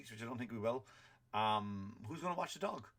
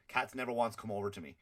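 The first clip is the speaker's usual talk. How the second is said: ordinary talk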